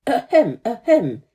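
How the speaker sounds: a pompous rocket's throat-clearing cough; mock cough. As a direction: clear the throat grandly for attention. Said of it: "He always coughed".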